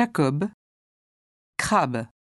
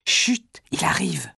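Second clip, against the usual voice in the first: whispered hush; whispering. Acted. Hushing before someone arrives.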